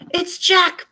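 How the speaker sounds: high and loud terrified bellow. A narrator's reading. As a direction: cry out the dreaded name. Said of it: "bellowed the flesh lump eater".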